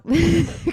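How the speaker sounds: bright high laugh; high burst of laughter. Real, not acted. Laughing after a cough.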